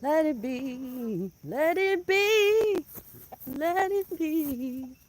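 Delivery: very slow, drawn out; slow coaxing. Real, not acted. Coaxing the rooster to leave the hen, very slow. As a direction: stretch each word to calm him. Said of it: "He don't wanna listen".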